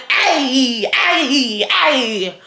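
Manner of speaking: drawn-out party whoop; partying crowd. Retold or imitated. Telling friend she'd rather be in that crowd, party cheer. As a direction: holler along like one of the crowd. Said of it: "be in that crowd going".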